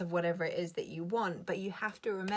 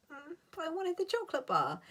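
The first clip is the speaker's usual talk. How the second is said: whiny inner voice; 10 semitones higher than usual. Retold or imitated. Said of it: "And my body goes".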